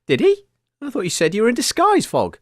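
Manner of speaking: normal talking voice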